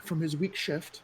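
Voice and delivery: a hint of a tearful widow; grieving widow. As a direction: tell it slowly as if about to break. GM voices the widow.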